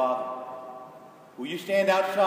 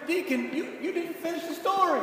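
much higher, mock complaining voice; mock congregant; 12 semitones higher than usual. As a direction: play a puzzled listener protesting. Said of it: "The story just".

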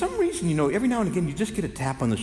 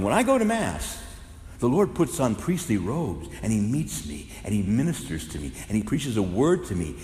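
usual speaking voice

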